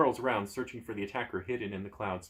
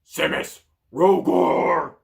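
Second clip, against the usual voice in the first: loud gruff call to the guards; gruff shouting, second name louder and higher; gruff yell; 6 semitones higher, 12 dB louder, much slower than usual. Acted. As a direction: bellow the names gruffly. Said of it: "A gruff voice yells".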